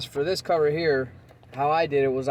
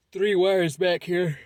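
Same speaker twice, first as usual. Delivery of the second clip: slow, higher words stretched by a yawn; 5 semitones higher, 6 dB louder, much slower than usual. Real, not acted. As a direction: talk through a big yawn. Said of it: "Excuse me for the yawn".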